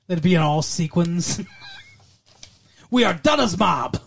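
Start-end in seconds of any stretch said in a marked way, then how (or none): 2.9-4.0 comic attempt at a Mexican-ish accent; mock mobster accent. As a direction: announce the gang in a silly foreign accent. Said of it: "I don't know why they have that accent".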